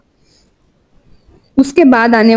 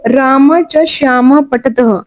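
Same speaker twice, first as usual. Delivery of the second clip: slow Sanskrit recitation; much slower than usual. Shown as a demonstration. Teacher slowly reading the example sentence to the class. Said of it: "वाक्य में प्रयोग".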